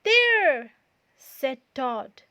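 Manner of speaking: loud and high in triumph; loud pleased finish; triumphant. A narrator's reading. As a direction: declare it done with satisfaction. Todd satisfied.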